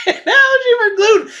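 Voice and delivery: high laugh breaking into the words. Real, not acted. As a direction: crack up while saying it. Laughing at the gluten allergy story.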